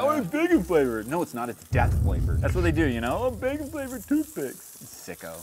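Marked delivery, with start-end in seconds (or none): 0.0-1.1 high-pitched mock delight; mock enthusiast. Mockingly voicing fans of bacon flavor. 3.2-4.8 very high, silly put-on voice; mock enthusiast. Mockingly voicing fans of bacon flavor.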